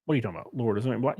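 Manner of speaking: ordinary talk, nothing marked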